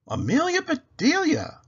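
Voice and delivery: high, loud lady of the house calling; Mrs Rogers voice. Acted. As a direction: call her name up high like the lady of the house.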